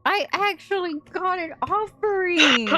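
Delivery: high, slow, eager ghostly voice; Kazuki's ghost pleading proudly. Acted. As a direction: sound like an excited spirit. Ghost voice in story.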